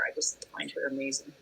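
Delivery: soft, breathy, admiring whisper. Real, not acted. Panelist confiding how much she admires Kathy Bates, whispered.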